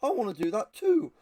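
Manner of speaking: high mock enthusiasm, exclaimed; excited copycats. Everybody sees two guys walking around, mock excited. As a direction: imitate an over-keen crowd wanting in. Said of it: "everybody goes".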